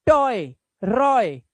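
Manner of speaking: very slow drawn-out oy words; very slow model words. Shown as a demonstration. Teacher modelling oy words very slowly. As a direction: stretch each oy word out. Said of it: "ऑय से पढ़े".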